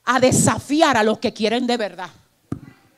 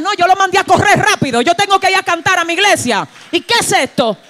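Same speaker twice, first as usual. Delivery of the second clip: excited friend; 7 semitones higher, 6 dB louder than usual. Retold or imitated. Retelling a friend beating covid.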